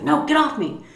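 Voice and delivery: angry shove-off. Retold or imitated. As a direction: push someone away angrily. Aaron shoving his mother.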